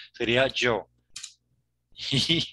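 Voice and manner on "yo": "yo" with a Portuguese-style J. Shown as a demonstration. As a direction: buzz the first sound of "yo" like a Portuguese J. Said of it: "Yota portuguesa, no española".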